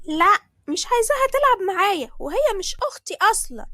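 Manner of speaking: high, loud stubborn refusal; big sister shouting she isn't her sister; bratty child shout. Acted. Sally refusing to mother.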